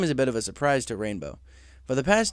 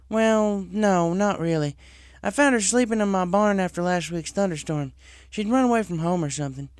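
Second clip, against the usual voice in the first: higher, lively farm-pony voice; Applejack voice; 7 semitones higher than usual. Acted. Applejack tells Cloud Chaser her orchards were already clear. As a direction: tell it with amazement.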